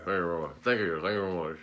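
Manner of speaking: mumbled, low [name] impression; a lightly mumbled [name]; [name] with marbles in mouth. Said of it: "doing [name] with marbles in my mouth".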